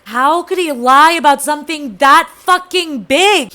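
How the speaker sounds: loud, drawn-out incredulous outrage; outraged disbelief. Real, not acted. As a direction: hammer out each word in indignation. Outrage at discovering his lie.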